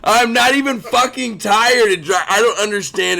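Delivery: loud, high, frustrated insistence; worked-up, real frustration; exasperated protest. Real, not acted. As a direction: protest hotly that you are wide awake. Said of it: "It drives me nuts".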